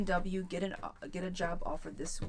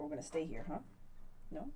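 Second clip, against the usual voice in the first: normal talking voice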